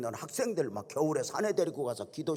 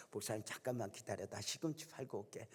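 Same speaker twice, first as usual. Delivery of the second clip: soft aside; 3 semitones lower, 12 dB quieter than usual. Retold or imitated. Deacon stepping away to a customer.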